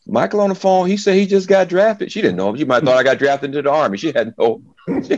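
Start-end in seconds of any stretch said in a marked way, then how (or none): 0.0-2.1 much higher, mom calling out to the family; imitating mom. Mom calling to the house that her son got drafted, high imitation. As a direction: raise your voice way up like mom shouting the news. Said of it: "You can hear in the background saying".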